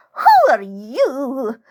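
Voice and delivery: put-on high, slow, barking old crone; barking old woman. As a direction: snap it out high and suspicious. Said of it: "she barks".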